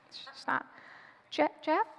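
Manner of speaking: hushed, hesitant calling out; timid soft call. Acted. Guest alone at the door calls boyfriend, timidly. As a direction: call softly, unsure anyone will hear.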